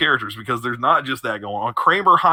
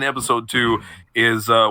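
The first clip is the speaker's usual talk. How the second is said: normal talking voice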